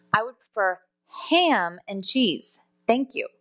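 "ham" stretched out after a short pause; "ham" set apart and emphasized; stress on HAM. Shown as a demonstration. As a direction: hit the word "ham" and draw it out. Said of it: "use this example to emphasize certain words".

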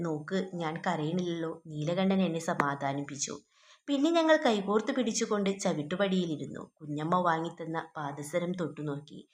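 quiet literary read-aloud; story narration. A narrator's reading. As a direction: read the tale aloud gently, below teaching volume. Reads a short story aloud.

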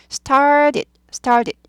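"started" with a quick d-like middle t; t weakened to d. Shown as a demonstration. Teacher corrects learners' "started", t weakened to d. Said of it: "트가 드로 약화되죠".

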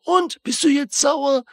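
high, drawn-out imitation of a goading yell; mock shout. David Harbour's character goads the driver, mock shouting. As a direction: stretch it out high, like mimicking someone shouting. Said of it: "schreit er so".

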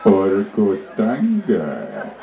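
very low, slow chief question; deep chief voice. Acted. Chief asking the second missionary, very low and slow. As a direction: drag out the choice ominously low.

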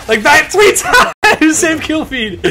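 high, slow words broken by laughs; laughing. Real, not acted. Gamer laughing to friends about the kill feed, laughing. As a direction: laugh through the disbelief.

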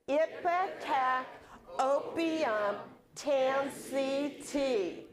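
slow, carefully enunciated chant; over enunciating. Shown as a demonstration. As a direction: spell out each word slowly. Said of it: "we're going to do this very slowly. Okay, and enunciate".

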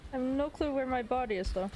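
usual speaking voice

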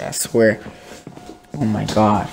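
ordinary talk, nothing marked

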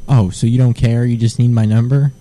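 ordinary delivery

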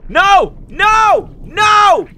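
very high, full-volume frantic shouts; panicked screaming. Real, not acted. Player attacked while trying to figure it out, screaming in panic.

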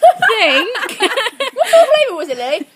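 loud, slow laughing through disgust; laughing with mouth full. Real, not acted.

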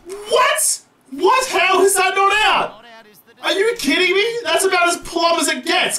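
high, indignant yelling at the umpire; outraged disbelief. Real, not acted. Gamer rages at the umpire's not-out decision. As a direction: protest the not-out call. Umpire denies obvious lbw.

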